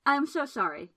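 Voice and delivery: flustered man blurting an apology; blurted apology. A narrator's reading. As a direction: blurt it out in a rush of embarrassment. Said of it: "he blurted".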